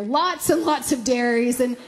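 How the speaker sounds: ordinary delivery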